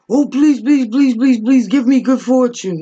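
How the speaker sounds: high, loud singsong begging; mock pleading. Preacher mimicking New Year's wishers begging for good fortune, mock pleading. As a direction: beg in rapid, exaggerated repeats.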